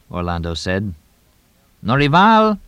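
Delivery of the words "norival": calling out. Acted. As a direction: call out loud and high across the house. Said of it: "Tonino called in Portuguese".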